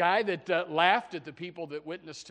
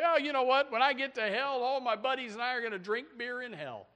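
higher, careless voice of a boastful sinner; lightly mocking scoffer; 9 semitones higher, 5 dB louder than usual. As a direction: tease the cocky excuse in a lighter voice. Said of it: "and they say".

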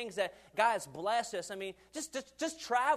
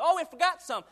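mock greedy gatherer; 8 semitones higher, 9 dB louder than usual. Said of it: "you turn around like".

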